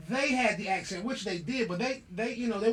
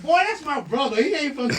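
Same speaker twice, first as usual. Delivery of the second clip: higher, louder retelling through laughter; laughing mock scold; 6 semitones higher, 8 dB louder than usual. Retelling his sister correcting Troy, laughing loudly. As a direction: play the big sister setting him straight while cracking up. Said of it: "She said".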